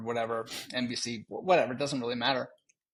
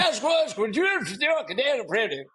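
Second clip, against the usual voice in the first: mock Scottish accent; 10 semitones higher, 6 dB louder than usual. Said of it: "what it sounded like to me".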